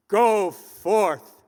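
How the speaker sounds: booming minister's voice; thundering. Retold or imitated. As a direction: thunder it from the pulpit. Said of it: "thundered it".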